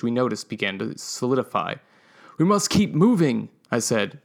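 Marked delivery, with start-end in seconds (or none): 2.4-3.5 urgent-sounding, higher imitation of a companion; lightly voiced companion, pitched up; channeling Macy. A narrator's reading. Narrator rallying the diggers in Macy's manner, channeling her. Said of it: "channeling Macy".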